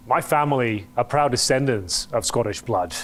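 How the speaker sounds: normal talking voice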